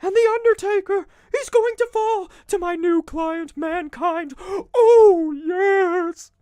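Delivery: very high, loud, creepy manager wail; Paul Bearer voice. Retold or imitated. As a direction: promise the win in a high, creepy, hammed-up wail. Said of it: "it's like".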